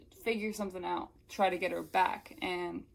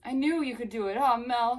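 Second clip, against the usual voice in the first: higher, louder relieved cry; acted relief; 5 semitones higher, 7 dB louder than usual. Jared finding Mel is back, louder relieved voice. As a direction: let relief burst out. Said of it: "He groaned in relief".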